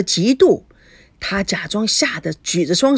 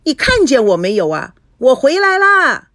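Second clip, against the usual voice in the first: insistent shouting; 6 semitones higher, 5 dB louder than usual. A narrator's reading. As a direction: shout for mother's attention, stretching the end. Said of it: "菲菲又喊了". (扯着嗓子拖长音喊)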